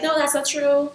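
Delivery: lightly acted high-pitched protest. Voicing a reply to negative voice.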